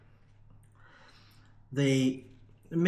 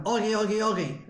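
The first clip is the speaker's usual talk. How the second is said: higher, half-hearted shout of oggy; mild mock shout; 5 semitones higher than usual. Voicing the pit-top call down to miners, mild mock shout. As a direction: call it down the mine shaft, lightly. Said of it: "they would shout down".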